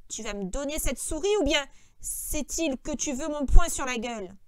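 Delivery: loud threat, falling away; cold threat. A narrator's reading. George threatening Lenny over the mouse, cold. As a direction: threaten him firmly. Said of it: "Georges lui dit froidement".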